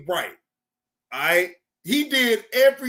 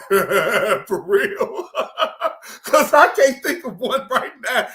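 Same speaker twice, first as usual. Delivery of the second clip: laughing; 4 dB louder, slower than usual. Real, not acted. Laughing while asking for suggestions.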